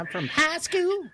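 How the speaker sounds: high, slow mimicry of a Southern drawl; drawn-out, high Southern send-up; mock Southern accent. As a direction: parody a Southern high school, slurred as hah-skoo. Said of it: "South Carolina Southern accent sounds real sloppy".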